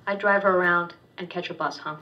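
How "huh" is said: usual speaking voice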